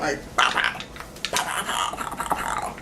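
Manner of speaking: breathy whispered pow pow, soft and rapid; hushed gunfire sounds, lightly mimicked; imitated gunshots. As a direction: mouth the gunshots under your breath.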